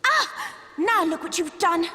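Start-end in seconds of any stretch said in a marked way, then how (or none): 0.0-0.5 sharp high cry of pain. Acted. Ida is struck while holding off foes, sharp cry. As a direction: yelp as the blow lands. Hit during the fight.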